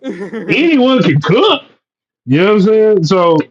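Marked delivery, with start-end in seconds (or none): 0.0-1.6 Ratatouille quote. Retold or imitated.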